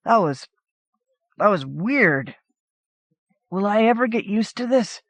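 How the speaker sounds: talking through a mouthful of burger; mouth full. A narrator's reading. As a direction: say it round a big bite. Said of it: "I said with my mouth full".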